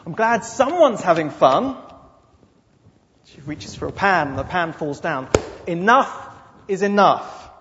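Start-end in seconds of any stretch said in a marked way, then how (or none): 0.0-1.8 sarcastic jab. Acted. Martha muttering sarcasm. 5.7-7.5 louder, exasperated outburst; fed up. Acted. Martha losing patience.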